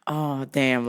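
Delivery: low, deflated little letdown; mock sad. Traveler let go by the agent, mock sad. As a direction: act out your disappointment, pitch dropped. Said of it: "I was all sad".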